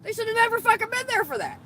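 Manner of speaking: real indignant shout; angry outburst. Real, not acted. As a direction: let the outrage break out. Outrage at police killing.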